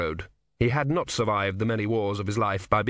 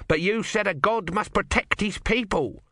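mock biblical prophet; 9 semitones higher than usual. God argues with Brother.

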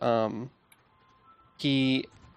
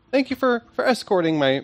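high, loud courteous thanks; a lightly voiced courteous stranger; polite thanks; 9 semitones higher, 5 dB louder than usual. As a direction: tip the hat and thank them politely. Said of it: "nods his hat and says".

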